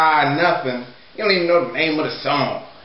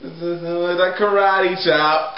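normal talking voice

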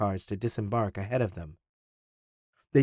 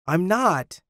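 high-pitched protest; narrated indignant denial; 7 semitones higher than usual. A narrator's reading. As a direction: deny it, voice jumping up. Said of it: "Tim protested".

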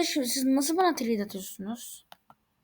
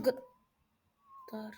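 slow whispered aside; 12 dB quieter than usual. Real, not acted. Streamer muttering privately mid-trade, slow whisper. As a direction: breathe it under your breath.

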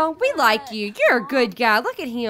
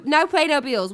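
ordinary talk

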